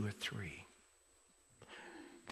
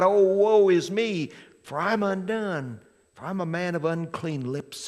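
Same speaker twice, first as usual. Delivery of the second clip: crying out; 5 semitones higher, 9 dB louder than usual. Retold or imitated. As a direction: cry it out like a man undone. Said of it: "and he cries out".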